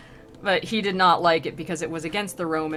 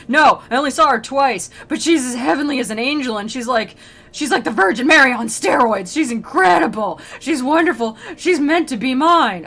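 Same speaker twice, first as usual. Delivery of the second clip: loud, raised, hammed-up infatuation; mock rapture, pitched up and pushed; lovesick nerd; 5 semitones higher, 6 dB louder than usual. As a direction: insist feverishly, like an obsessed admirer. Lovesick Dante arguing with questioner.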